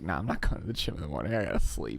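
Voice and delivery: low, quick refusal; dismissive self-quote. Retold or imitated. Host talks themself out of the gym, dismissive. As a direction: drop low to wave the plan away.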